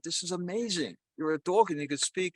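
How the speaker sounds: lightly acted astonishment at a marvel; amazed. Man meeting a talking dog in a joke, lightly amazed.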